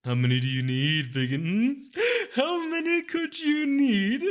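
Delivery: high, loud, slow wheedling merchant; teasing greedy seller; sly mysterious shopkeeper. Acted. Shopkeeper voice held.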